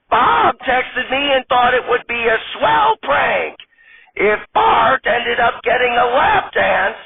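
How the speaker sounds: put-on sheepish old grandma apologizing; apologetic old granny. Old lady character voice.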